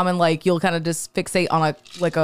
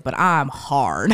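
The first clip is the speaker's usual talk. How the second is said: slow mock lust; 5 semitones lower, much slower than usual. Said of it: "I was like".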